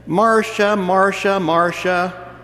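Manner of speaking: the famous TV sister complaining, loud and high; Brady Bunch Marcia. Retold or imitated. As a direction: wail the name like Jan in the Brady Bunch. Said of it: "sound like the Brady Bunch".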